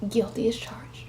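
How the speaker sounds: a hushed confession to viewers; whispered aside. Real, not acted. Aside after Madea clip.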